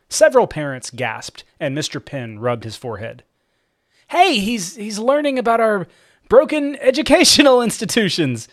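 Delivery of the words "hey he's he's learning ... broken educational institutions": put-on high, hurried boy voice; weakly defending the kid; nervous kid excuse. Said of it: "Cooper offered".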